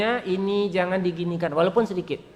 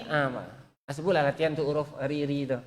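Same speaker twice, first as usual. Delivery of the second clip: ordinary talk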